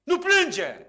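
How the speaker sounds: high, loud, ringing command; commanding call. Retold or imitated. Jesus to the weeping widow, loud and commanding. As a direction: call out to stop her grief. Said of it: "Și spune".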